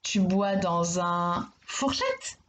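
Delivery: slow, mock-puzzled, rising on fourchette; doubtful wrong-answer question. Shown as a demonstration. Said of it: "ça ne veut rien dire". (question faussement étonnée, lente)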